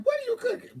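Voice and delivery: high squeaky imitation of the neighbor; nosy neighbor. Neighbor asks what she's cooking, in a high squeaky voice. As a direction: pitch way up, playing the curious neighbor. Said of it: "my neighbor was like".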